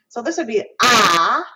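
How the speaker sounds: loud, bright open ah; bright ah demo. Shown as a demonstration. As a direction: give a bright ah. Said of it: "our American A that's very bright".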